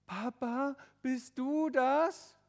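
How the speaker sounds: high, drawn-out hopeful question; relieved to see someone at last; hopeful surprise. Acted. Tobi spotting his father above, hopeful surprise.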